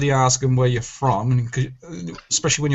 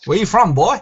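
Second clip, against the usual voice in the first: Foghorn Leghorn voice; 5 semitones higher, 5 dB louder than usual. Pretend. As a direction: boom it like a cartoon rooster. Foghorn impression.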